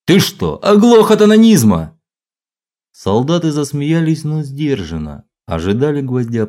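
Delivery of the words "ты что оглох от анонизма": high, loud mocking yell. A narrator's reading. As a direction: yell it to the whole barracks. Sergeant yelling mockery.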